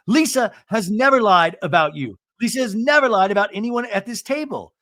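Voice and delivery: high, insistent declaration; emphatic retold defense. John defending Lisa to the table, high and insistent. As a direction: declare it with mock conviction.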